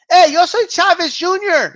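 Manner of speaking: high, loud Spanish-speaking boxer impression; sober Chavez Junior. Streamer showing how the boxer talks sober, high impression. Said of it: "when he's sober, he talks like this".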